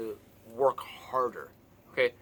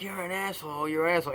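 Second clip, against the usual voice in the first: put-on childish taunt, "asshole" slightly higher; teasing; 6 semitones higher than usual. Shown as a demonstration. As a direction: tease like a kid in the schoolyard. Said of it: "in a teasing way".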